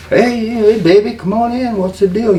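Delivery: imitating Dusty's easy greeting; Dusty Rhodes impression. Storyteller voicing Dusty greeting him at the door, high imitation.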